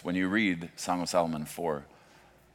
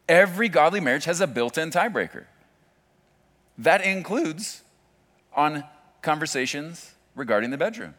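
much higher and louder, hammering the refrain; full-force refrain; 11 semitones higher, 8 dB louder than usual. Real, not acted. Pastor repeating his refrain to the congregation, full preaching force. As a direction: drive the line home as a peak.

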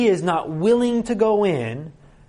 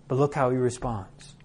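ordinary delivery

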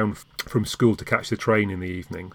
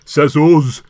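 put-on Yorkshire accent; 6 semitones higher, 10 dB louder than usual. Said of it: "a very nice Yorkshire accent coming in that says".

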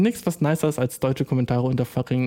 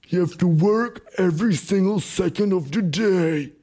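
high, drawn-out motivational shouting; motivational account; 7 semitones higher, slower than usual. Acted. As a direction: parody an over-intense hustle coach. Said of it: "dann fucking Motivational Accounts".

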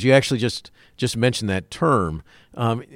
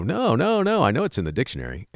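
high-pitched "no, no, no", then quicker; mock insistent objection; 5 semitones higher than usual. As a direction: imitate someone sure they know better. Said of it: "they would go".

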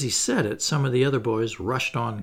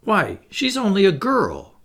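higher-pitched, mildly scandalised protest; aghast; 7 semitones higher, 4 dB louder than usual. A narrator's reading. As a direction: protest in disbelief.

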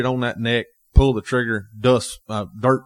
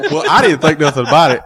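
louder, laughing as he talks; can't hold the laugh in; 5 semitones higher, 9 dB louder than usual. Real, not acted. Guest to host retelling the hunt, laughing.

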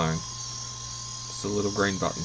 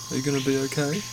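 playful; 5 semitones higher, faster than usual. Retold or imitated. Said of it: "the dad says playfully".

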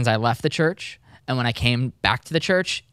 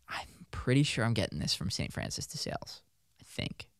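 whispered aside; 4 semitones lower, 11 dB quieter than usual. Real, not acted. Unsure aside, voice drops away.

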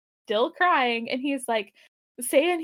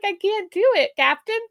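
Scotty pleading; 7 semitones higher, 5 dB louder than usual. Retold or imitated.

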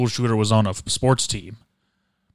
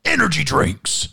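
mock X Games voice; slower than usual. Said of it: "X Games was like".

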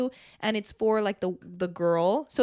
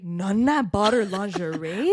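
slow, disbelieving repeat; shocked echo; 4 dB louder, slower than usual. Retold or imitated.

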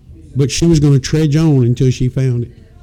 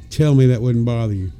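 ordinary talk, nothing marked

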